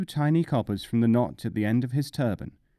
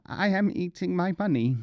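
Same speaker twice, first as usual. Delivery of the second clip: croaked; 7 semitones higher than usual. Acted. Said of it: "croaked Nasruddin".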